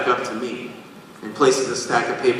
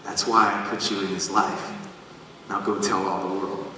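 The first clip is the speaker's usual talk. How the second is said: quiet voice of God; 4 semitones lower, 7 dB quieter than usual. Retold or imitated. God's quiet answer.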